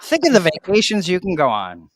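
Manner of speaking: loud, high dreamy ad pitch; infomercial voice. Pretend. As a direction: sell the dream vacation. Infomercial bit continued.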